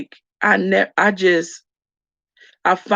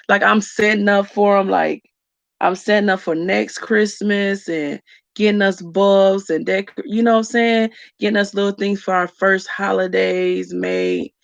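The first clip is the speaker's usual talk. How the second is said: ordinary delivery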